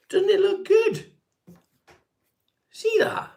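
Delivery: very high, slow squeal of mock pride; high falsetto showing off his hair; mock squeaky delight. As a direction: squeal it like a thrilled child. Showing off his combed-over hair.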